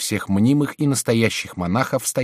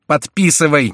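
raised, loud, quicker impatient order; impatient cry; 7 semitones higher, 8 dB louder than usual. A narrator's reading. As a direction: snap out the command impatiently. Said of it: "нетерпеливо вскричали Майен и герцогиня". (нетерпеливый окрик)

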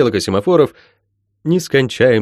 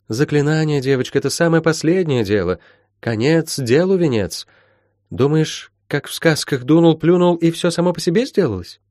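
higher, lilting mentor's correction; dismissive healer; 7 semitones higher than usual. Acted. Healer telling Molly spells come last, mildly scornful. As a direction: set the girl straight, lightly in character.

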